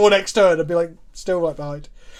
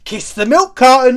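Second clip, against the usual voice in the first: shouting; 4 semitones higher, 9 dB louder than usual. Pretend. Wrestler persona catchphrase.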